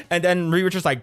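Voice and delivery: ordinary talk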